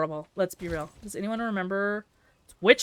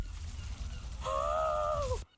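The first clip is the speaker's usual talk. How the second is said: gasp. Real, not acted. Dramatic gasp.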